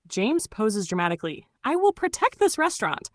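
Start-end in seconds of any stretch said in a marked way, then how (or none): 1.6-3.1 put-on bright magical girl. Magical girl pose scene.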